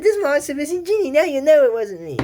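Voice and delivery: high, fast squeaky cartoon voice; excited high-pitched squeak, pleading innocence. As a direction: squeak it out quickly like a tiny genie. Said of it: "has this squeaky voice".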